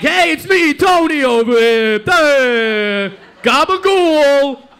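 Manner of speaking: loud, high, hammed-up Italian-American guy. Playing the Tony waspy people want, hammed-up shouting. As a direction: holler it broadly with drawn-out endings. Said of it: "they want me to be like".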